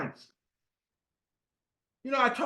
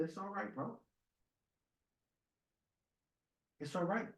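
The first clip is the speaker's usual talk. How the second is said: hushed, understated reassurance; soft consoling; 2 semitones lower, 17 dB quieter than usual. Retold or imitated. Driver consoles a crying passenger, hushed and reassuring. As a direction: say it very softly, with room between the phrases.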